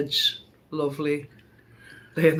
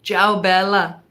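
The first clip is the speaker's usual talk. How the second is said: loud, laughing hammed-up Italian goodbyes; sing-song fake Italian farewells between laughs; mock Italian accent; 4 semitones higher, 9 dB louder than usual. Saying goodbye to June like Italians, loud mock Italian accent. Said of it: "I'm even trying to do the accent".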